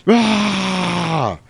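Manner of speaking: long, high roaring wail; drawn-out creature cry; mock monster scream. Laddie bursts from the bed flinging sheets, monster scream.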